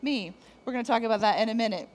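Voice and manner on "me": doubtful hesitation. Retold or imitated. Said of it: "Others of you are like".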